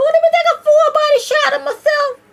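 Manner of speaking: loud, very high excited quote; hammed-up high-pitched glee; mock delight. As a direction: squeal your bright idea to yourself. Quotes own delight at a mirror.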